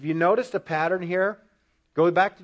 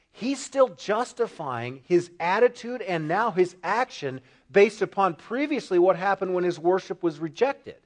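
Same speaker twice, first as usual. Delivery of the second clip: ordinary talk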